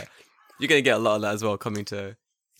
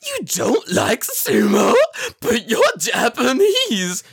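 high, loud, very slow incredulous; mock disbelief; 12 semitones higher, 8 dB louder, much slower than usual. Mocking the Japanese guy with high, loud, slow disbelief. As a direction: drag out the fake shock, turning his own question back on him. Mirroring his shock back.

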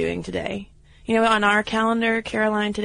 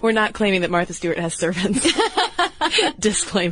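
breaking into real laughter; laughing disclaimer. Real, not acted. Host jokingly disclaims servants to co-host, laughing. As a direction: say it through a laugh.

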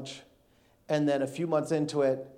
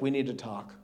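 ominous wife; 5 semitones lower, 7 dB quieter than usual. Retold or imitated. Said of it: "when your wife's like".

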